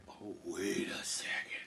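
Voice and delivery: slow, whispery second thought; hushed realization. Acted. Jack remembering his mother's rule, hushed. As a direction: drop to a whisper as it dawns. Jack suddenly recalls instruction.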